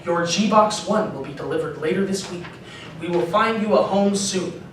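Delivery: usual speaking voice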